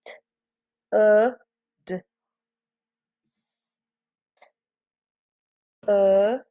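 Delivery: isolated letter sounds "T-, uh, d-, uh", one at a time; sounding out. Shown as a demonstration. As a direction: tap out each sound of "stood" separately, with long gaps. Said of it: "Let's tap that out".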